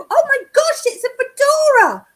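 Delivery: high, loud burst of delight; delighted surprise. Real, not acted. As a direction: squeal over the turkey fedora. Delighted by the turkey fedora.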